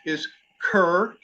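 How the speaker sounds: normal talking voice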